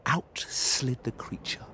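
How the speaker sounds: plain reading voice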